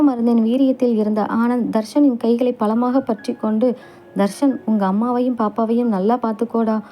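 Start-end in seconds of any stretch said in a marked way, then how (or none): none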